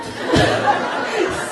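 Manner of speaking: high breathy laugh of proud delight; real laugh. Real, not acted. Mother laughs at son's wisdom.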